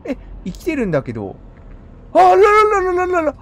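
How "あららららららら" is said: high, loud rattled "あらららら"; blurting out alarm at the sight; startled babble. Real, not acted. Gamer sees a giant creature, loud startled babble.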